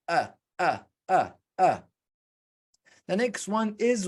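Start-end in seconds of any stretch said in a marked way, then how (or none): 0.1-1.8 A with lips apart; teacher showing lips apart for A; open vowel demo. Shown as a demonstration. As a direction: model the open A clearly. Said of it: "your lips is aparted".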